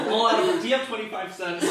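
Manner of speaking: a customer line squeezed out through giggles; laughing customer quote. Acted. Laughing while acting a customer.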